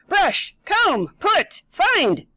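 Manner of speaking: high, loud staccato mock orders; barking out commands. Mom shortens her spiel, barking one-word commands. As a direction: bark each word as its own order. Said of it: "start barking out command".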